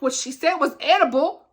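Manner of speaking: usual speaking voice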